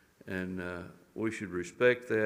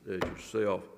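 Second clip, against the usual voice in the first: ordinary talk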